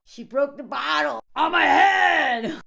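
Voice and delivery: slow, loud, hammed-up tale of woe; dramatic man's voice. Reacting to a drunk man's story, mock dramatic and loud.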